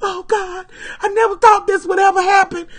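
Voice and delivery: high, wailing sobbed words; crying. Retold or imitated. Driving away from the park, crying to herself. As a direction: cry it out in disbelief.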